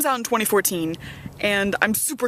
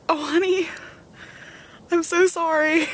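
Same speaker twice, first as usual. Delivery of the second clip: apologetic; 10 semitones higher than usual. Retold or imitated. Apologizing to classmate.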